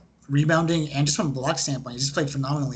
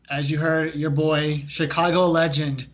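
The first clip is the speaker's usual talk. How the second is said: ordinary talk, nothing marked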